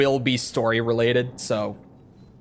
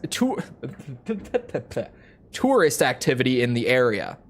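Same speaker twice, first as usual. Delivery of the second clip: ordinary delivery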